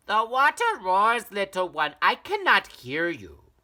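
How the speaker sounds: high, loud mother's voice over the roar; distracted mother. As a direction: call over rushing water, unhearing. Mother answers from afar.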